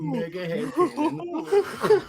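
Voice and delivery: very high, slow, drawn-out squeal; mocking falsetto. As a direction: stretch it out high to tease. Mocking repeat of own exclamation.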